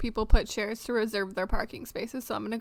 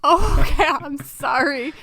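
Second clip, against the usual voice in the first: laughing apology; 4 semitones higher, 11 dB louder than usual. Real, not acted. Caught jumping ahead of quiz.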